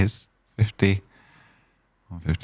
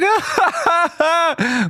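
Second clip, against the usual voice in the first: high laugh. Real, not acted. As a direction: let a squeal of laughter out. Laughing mid sentence.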